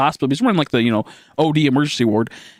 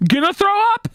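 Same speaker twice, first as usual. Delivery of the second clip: much higher, whiny queasy voice; nauseous whimper. Retold or imitated. Telling the nurse he'll vomit, high whiny moan. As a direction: moan it as if about to puke. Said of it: "I remember saying".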